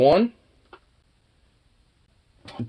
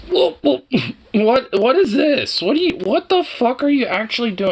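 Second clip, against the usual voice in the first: surprised; 9 semitones higher than usual. Real, not acted. Dog suddenly rolling off.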